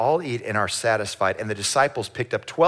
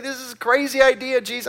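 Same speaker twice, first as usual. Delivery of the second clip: incredulous; 12 semitones higher, faster than usual. Retold or imitated. Disciple protesting to Jesus.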